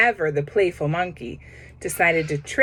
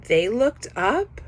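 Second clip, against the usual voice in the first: usual speaking voice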